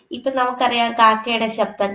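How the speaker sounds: normal talking voice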